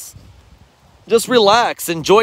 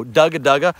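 low dugga dugga chatter; feeder chuckle imitation; 5 semitones lower, faster than usual. Shown as a demonstration. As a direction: mimic a feeding duck's chuckle. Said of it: "We go".

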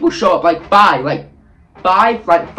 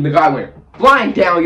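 ordinary talk

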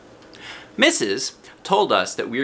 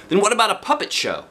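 put-on eager boy; 11 semitones higher, faster than usual. A narrator's reading. Jamal voiced.

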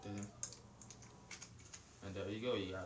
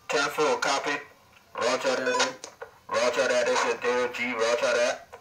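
radio operator; 3 semitones higher, 13 dB louder than usual. Pretend. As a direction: play a security guard on the radio. Playing radio chatter.

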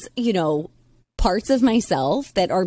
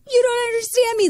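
put-on high, whiny teenage outburst; ragey teen. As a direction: blurt it like a furious teen. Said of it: "get real ragey".

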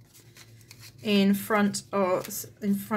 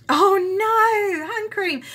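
surprised; 11 semitones higher, 6 dB louder, much slower than usual. Real, not acted. Knocks over hand cream.

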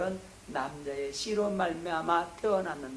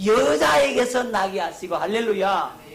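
much louder, higher preaching cry; emphatic preaching; 4 semitones higher, 9 dB louder than usual. Real, not acted. Preacher confirms the congregation's answer, emphatic and loud. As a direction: proclaim the answer at full force.

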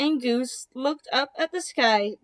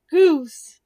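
loud call to Goose; 3 semitones higher, 9 dB louder than usual. Acted. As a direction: shout the name to get attention. Duck calling out to Goose.

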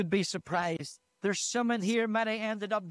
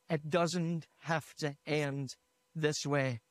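very slow, measured and low; solemn refrain; 4 semitones lower, 3 dB quieter, slower than usual. Retold or imitated. Preacher quoting the one unforgettable line, slow and solemn. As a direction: let each word land, slowly and lower.

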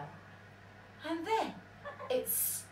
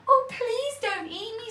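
high squeaky plea; pleading mouse; 12 semitones higher than usual. A narrator's reading. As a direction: beg like a frightened little mouse. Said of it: "said the mouse".

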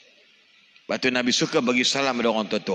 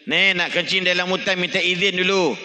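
higher, louder voicing of the old folk's polite request; elders' polite saying; 7 semitones higher, 3 dB louder than usual. Preacher voicing old folk asking the forest's leave, higher and louder. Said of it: "Kalau orang dulu kata".

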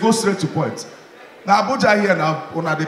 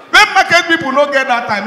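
high, loud laughing punchline; laughing shout; 11 semitones higher, 6 dB louder than usual. Real, not acted. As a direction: burst out with it through a laugh.

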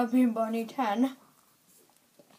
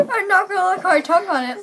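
laughing; 7 semitones higher, 11 dB louder, faster than usual. Real, not acted. Tasting mystery food, laughing loudly.

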